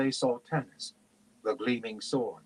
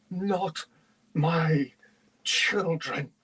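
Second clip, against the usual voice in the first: higher voice, each word set apart; rage; 6 semitones higher, much slower than usual. A narrator's reading. Mother dragon defending children, enraged. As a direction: force out each word in fury. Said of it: "she said with rage".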